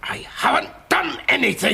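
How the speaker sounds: high-pitched outburst of denial; agitated protest. Acted. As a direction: burst out protesting your innocence. Suspect protests innocence under pressure.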